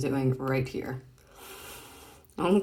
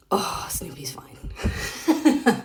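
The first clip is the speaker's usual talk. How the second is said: breathy laugh through the words, very slow; laughing whisper; 3 semitones higher, 4 dB louder, much slower than usual. Real, not acted. Delighted by Snoopy in the comic, a laughing whisper. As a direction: giggle it out under your breath.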